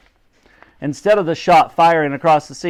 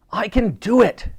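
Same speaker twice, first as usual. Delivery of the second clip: higher, snappy retort; snappish; 9 semitones higher than usual. A narrator's reading. Titch refuses Dottie's help, snappish. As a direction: snap back in a raised pitch. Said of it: "he snapped".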